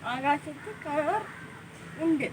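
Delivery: "ആകാശത്ത് കാർ ഉണ്ട്" slow and soft, mouth unmoving; soft, halting "ആകാശത്ത് കാർ ഉണ്ട്" through a motionless mouth; mouth held still. Shown as a demonstration. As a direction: say "ആകാശത്ത് കാർ ഉണ്ട്" slowly without moving the lips. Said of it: "വാ അനക്കാതെ ഇത് നീ എങ്ങനെ".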